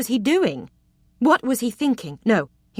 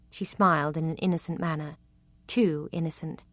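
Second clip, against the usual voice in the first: ordinary delivery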